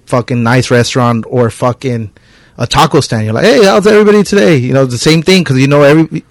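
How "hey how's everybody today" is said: cheerful greeting. Retold or imitated.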